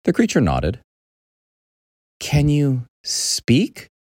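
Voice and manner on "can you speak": put-on high boy voice, drawn out word by word; slow, careful question. Boy asking a creature if it talks, slow and careful. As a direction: ask very slowly, rising at the end.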